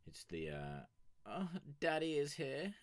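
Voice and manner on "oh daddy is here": high playful announcing; high mock-excited call; teasing high voice. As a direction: tease him like a fan.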